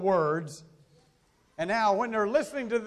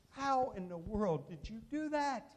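amazed father; 3 semitones higher, 7 dB quieter than usual. Retold or imitated. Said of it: "the father is going".

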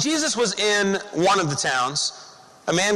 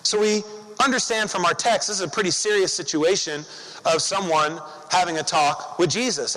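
normal talking voice

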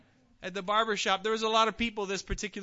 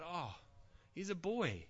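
inner thought; 6 semitones lower, 9 dB quieter than usual. Retold or imitated. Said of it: "I thought".

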